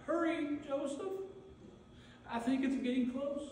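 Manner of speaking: a faint tearful urge to hurry; Mary crying. Acted. Mary in labour begging Joseph to hurry, softly tearful. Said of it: "You just hear her through a cry saying".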